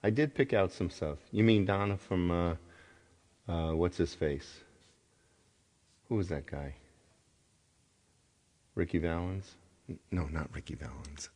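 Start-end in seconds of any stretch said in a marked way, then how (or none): 6.1-11.3 quick whispered correction to himself. Real, not acted. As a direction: mutter the fix under his breath.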